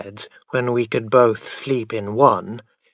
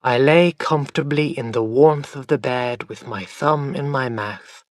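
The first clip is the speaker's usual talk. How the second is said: plain reading voice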